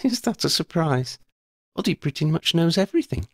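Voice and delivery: put-on a Ravenclaw girl asking gently if it's serious; lifted young voice for Shona; Shona, curious schoolgirl. A narrator's reading. Shona grinning.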